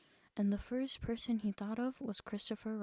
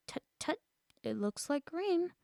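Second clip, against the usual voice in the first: fussy tut-tut; 6 semitones higher than usual. A narrator's reading. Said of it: "look up at me every now and then and say".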